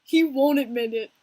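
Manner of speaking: usual speaking voice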